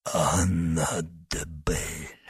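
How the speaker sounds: slow, low, soft dying whisper. A narrator's reading. Wounded man gives up the name, whispering. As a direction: breathe the name out slowly, weakly. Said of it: "прошептал раненый".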